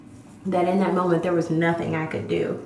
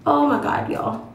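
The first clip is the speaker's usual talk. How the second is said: flustered anger; 7 semitones higher, slower than usual. Real, not acted. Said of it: "I can't even explain the anger".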